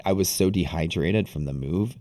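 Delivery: normal talking voice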